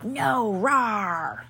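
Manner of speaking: short hammed-up growl of outrage; playful defensive roar; mock battle cry. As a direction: rah like a cartoon crusader.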